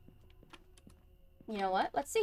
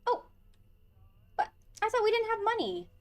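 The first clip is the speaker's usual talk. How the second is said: surprised high; 12 semitones higher, 5 dB louder than usual. Real, not acted. Shocked at purchase.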